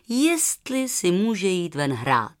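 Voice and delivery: ordinary delivery, nothing marked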